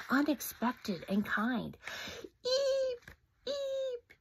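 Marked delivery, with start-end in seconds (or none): 2.4-4.1 high fading echoing eeps; distant mountain echo. Acted. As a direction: let each eep echo away. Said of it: "echoed from the mountains".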